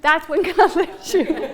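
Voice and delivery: slow, with a laugh breaking in before "God"; laughing repeat. Real, not acted. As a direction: laugh mid-line then land it.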